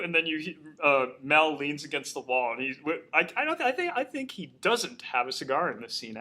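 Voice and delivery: ordinary talk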